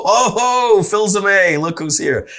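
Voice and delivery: loud, high oh ho welcome; delighted greeting. Retold or imitated. Dealership coworker greeting him, loud and high. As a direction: hail an old friend walking in. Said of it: "somebody will go".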